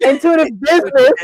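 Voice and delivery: correcting herself with a laugh; laughing correction. Real, not acted. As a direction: laugh through the fix. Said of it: "I said intuitive audience, huh?".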